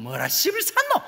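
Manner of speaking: high, loud, slow Gyeongsang lilt with a laugh; Gyeongsang accent. Shown as a demonstration. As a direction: pitch it way up like a Gyeongsang speaker. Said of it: "근데 경상도는".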